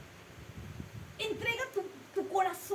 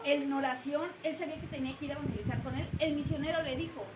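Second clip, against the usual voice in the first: ordinary talk, nothing marked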